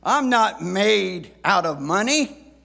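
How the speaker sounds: loud, high, slow fatherly complaint; stern dad voice. Retold or imitated. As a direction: grumble it like a tired dad. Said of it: "words that many dads have said".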